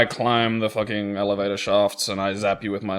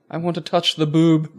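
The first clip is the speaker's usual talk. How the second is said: higher silly character voice; 6 semitones higher than usual. Pretend. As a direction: voice a space-mad crewman. Voicing a crazed game character.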